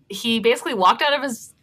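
usual speaking voice

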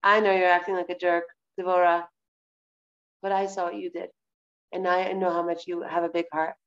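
low-pitched, accepting reassurance; understanding Hashem. Acted. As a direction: speak as a forgiving God, voice dropped low. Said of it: "the way you would want Hashem to deal with you".

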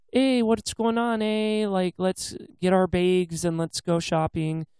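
mock Pacific Northwest Canadian shopper; Pacific Northwest Canadian accent. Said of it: "that normal accent of talking in that region".

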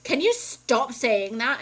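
slow it down with loud, fed-up emphasis; pissed off. Retold or imitated. Retelling fed-up complaint to husband, pissed off. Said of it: "I would get pissed".

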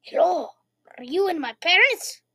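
exaggerated kid voice with swooping pitch; little boy Jimmy. Shown as a demonstration. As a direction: demonstrate the put-on little-kid accent. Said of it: "like this".

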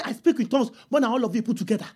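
hurried, understated recital; casual boast. Acted. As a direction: toss the boast off rapidly under the voice. Imagines boasting to the church.

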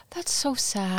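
soft and slow after a sharp gasp; gasped sympathy. Real, not acted. Host reacting to cohost's fourth-grade story, soft gasped pity. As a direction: let it out hushed, like it hurts to hear.

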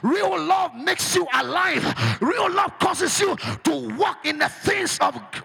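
loud, rousing preacher's cry to the church; preaching at full force. Acted. Pastor rallies the congregation to shout, full force.

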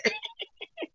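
very high squeaky giggle; high-pitched giggling squeal; squealing high laugh. Real, not acted. Laughs after quoting boyfriend.

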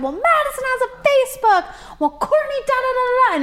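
high, whiny mock of her own younger self; teen tattling on her sister in a high mocking whine. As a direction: squeal it like a kid tattling back. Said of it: "I said".

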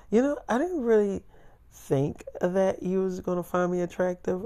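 high, soft imitated confession; insecure girlfriend. Voices girlfriend confessing self-doubt.

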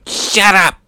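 high, short yell; hammed-up defiant shout; mock angry outburst. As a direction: cut the villain off with a cry. Fighter interrupts villain's speech.

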